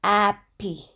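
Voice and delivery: Happy as Italian friend, with no h; Italian accent. Retold or imitated. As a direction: drop the h like an Italian. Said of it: "she would say".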